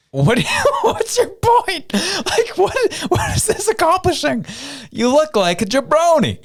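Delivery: high, squealing laughter through the words; giggling incredulous mock-rant; laughing disbelief. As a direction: crack up while asking.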